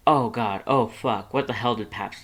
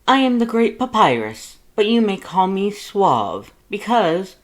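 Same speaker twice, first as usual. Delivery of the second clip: much higher, loud and booming skeleton voice; proud, cheery, boastful and loud; good-natured Papyrus; 9 semitones higher, 7 dB louder than usual. Acted. Papyrus introduces himself to Lust, booming and cheerful. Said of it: "Papyrus replied good-naturedly".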